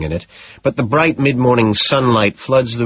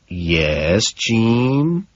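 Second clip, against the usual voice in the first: words spaced apart, rising at the end; condescending. A narrator's reading. Patrick talking down to his secretary, words spaced out. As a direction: talk down to her slowly. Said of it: "I ask condescendingly, spacing the two words out".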